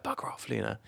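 very soft, hushed shooing; imitated whisper. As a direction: whisper it as if beside a sleeping child. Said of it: "I'd whisper it".

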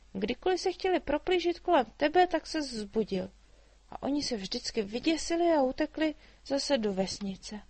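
put-on a chatty girl teasing the dragon; little girl Mája. A narrator's reading. Girl tells of villagers. (vysoký živý dívčí hlas)

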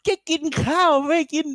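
high, whiny mock tantrum; imitating a kid's outraged squeal pitched high; angry Cartman. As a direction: throw a cartoon kid's angry fit. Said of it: "คัทแมนมันโมโหเว้ย".

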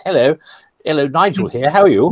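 lightly voiced, courteous hello; a hint of a proper phone greeting; mild polite caller voice; polite friend's greeting. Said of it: "it's more or less".

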